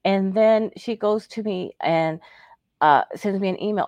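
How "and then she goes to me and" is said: ordinary talk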